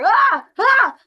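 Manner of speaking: high, loud panicked screaming; panicked screams. Retold or imitated. Trainee stuck on a steep roof, high panicked screams. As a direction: scream like you're stuck and scared. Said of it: "I hear".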